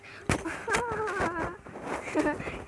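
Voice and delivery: high, drawn-out mock laugh; everybody-falls-down cartoon laugh; imitated cartoon laughter. Imitating the laugh ending each episode, high mock laughter. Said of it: "they're like".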